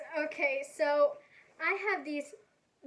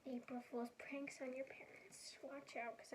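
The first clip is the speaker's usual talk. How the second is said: whispering; 13 dB quieter than usual. Acted. Sly announcement of prank theme.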